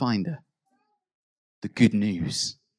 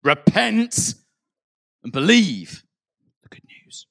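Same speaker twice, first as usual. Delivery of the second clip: booming threat fading to a hiss; threatening preacher; 11 semitones higher, 9 dB louder, slower than usual. Retold or imitated. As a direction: bark it like a hellfire warning, then whisper. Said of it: "use those words as kind of a threat".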